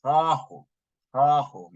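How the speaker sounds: "Hahu" with the ah held long; elongated ah. Shown as a demonstration. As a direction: draw out the ah in "Hahu". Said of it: "That's an elongated ah sound".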